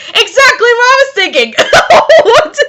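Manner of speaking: high-pitched, gleeful agreement; excited exclamation. Real, not acted. Reader cracking up at the fanfic's crude joke, excited exclamation. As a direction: burst out high and amused.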